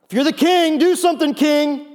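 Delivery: high, loud sneering jeer at the king; mock him from the crowd, loud and high; jeering taunt. Crowd jeers up at Jesus on the cross, loud mocking taunt.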